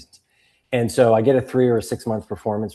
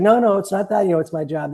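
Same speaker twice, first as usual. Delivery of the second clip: higher-pitched retold protest; defensive protest; 11 semitones higher, 4 dB louder than usual. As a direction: plead your case to the teasing buddies. Acted protest to teasing buddies.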